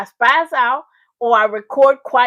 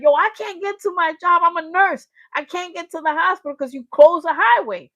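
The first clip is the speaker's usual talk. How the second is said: higher, pleading mock complaint; upset nurse; 6 semitones higher, 3 dB louder than usual. Nurse complaining about the closed highway, imitated upset. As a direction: protest in a raised, exasperated pitch.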